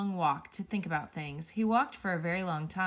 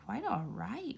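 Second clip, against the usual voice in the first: softer murmur of the phrase, half to himself; 2 semitones higher, 5 dB quieter, slower than usual. Acted. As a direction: echo the words under his breath in wonder. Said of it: "repeated Mister Good to himself".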